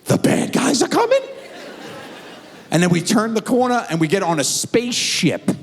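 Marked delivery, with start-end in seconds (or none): 0.1-1.2 put-on high little boy's voice, asking in fear; scared kid. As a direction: play the frightened five-year-old. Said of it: "And he goes".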